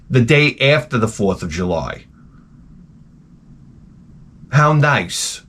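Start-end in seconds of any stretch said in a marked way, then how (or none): none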